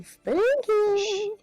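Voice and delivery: usual speaking voice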